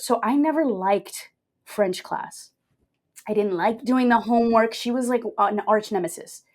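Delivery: ordinary talk, nothing marked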